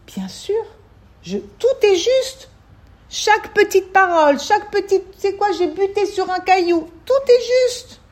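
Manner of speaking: high, loud, fervent proclamation; exalted soul's exclamation. Acted. Soul answering before coming down to this world, fervent high exclamation. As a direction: cry it out with soaring conviction.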